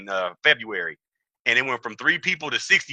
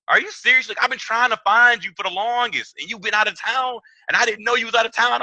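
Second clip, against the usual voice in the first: amazed disbelief; 8 semitones higher than usual. Retold or imitated. Surprised reply to the woman.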